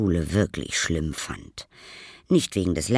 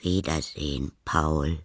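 put-on lower, unhurried old neighbour's farewell; Frau Wilhelm; 3 semitones lower than usual. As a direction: answer the boy in a low, calm voice. Old neighbour says goodbye. (ruhiger, tieferer Abschiedsgruß)

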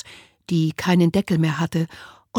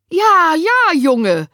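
high, loud insistent social worker; insist, baffled; loud high yes yes; Winnie confused; 10 semitones higher, 8 dB louder, much slower than usual. A narrator's reading. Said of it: "bestätigte die Sozialarbeiterin verwirrt".

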